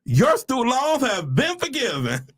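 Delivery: high, slow, triumphant proclamation; jubilant announcement. Acted. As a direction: draw it out like miraculous news. Announcing the letter's big news.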